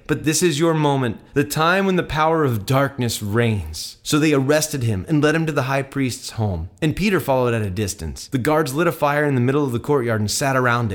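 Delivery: clear, projected scripture reading; Bible story narration. A narrator's reading. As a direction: read the gospel aloud with measured drama. Reads Bible passage aloud.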